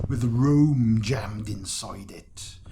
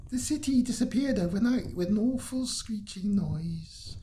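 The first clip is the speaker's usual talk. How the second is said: put-on a light, higher Italian accent for the local woman; 8 semitones higher than usual. Local woman tells the traveller Rome vanished, light Italian accent. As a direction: play her with a hint of an Italian accent. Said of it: "Don't know why she's got that accent".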